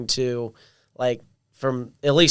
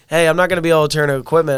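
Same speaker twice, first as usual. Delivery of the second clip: mock casual call; 4 semitones higher, 7 dB louder, faster than usual. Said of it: "And then you're like".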